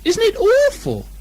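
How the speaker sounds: very high, frightened squeaky voice; a small pig clinging in terror; high piping dread; Piglet horrified. Acted.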